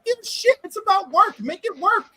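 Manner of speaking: high, pushy insistence; high-pitched mock outrage; insistent demand. Real, not acted. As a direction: demand he make it work. Demanding friend come to Heroes.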